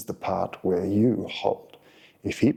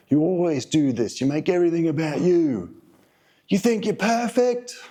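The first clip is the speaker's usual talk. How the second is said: higher, louder accusing jabs climbing to a shrill peak; mock angry partner; 7 semitones higher, 4 dB louder than usual. Coach imitates the angry partner's blaming outburst, mock furious. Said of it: "He'll start saying things like".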